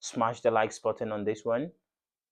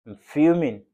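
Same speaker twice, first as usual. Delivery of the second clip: fuming; 5 dB louder than usual. Real, not acted.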